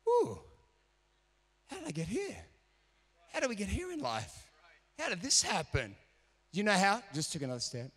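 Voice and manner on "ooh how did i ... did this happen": much higher wondering ooh; surprised wonder. Retold or imitated. Preacher discovering his progress, high surprised wonder. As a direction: marvel at where you've ended up. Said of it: "I went".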